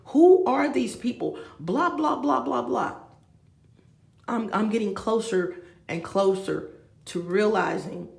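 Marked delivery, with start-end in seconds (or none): none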